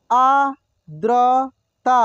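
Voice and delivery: आर्द्रता drawn out slowly, syllable by syllable; slow sounding out. Shown as a demonstration. Teacher slowly sounds out आर्द्रता for students. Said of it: "एक एक मात्रा को अलग अलग करके".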